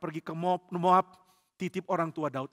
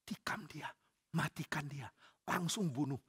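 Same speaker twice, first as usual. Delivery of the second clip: soft conspiratorial prompting; hushed urging; 9 dB quieter than usual. Acted. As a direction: urge murder under your breath. Men secretly urge killing in cave.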